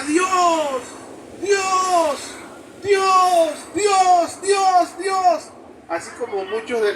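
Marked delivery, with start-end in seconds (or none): 0.0-5.4 high, loud desperate calls; calling out to God. Acted. Stranded climber crying out to God, pleading shout. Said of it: "comenzó a llamar a Dios".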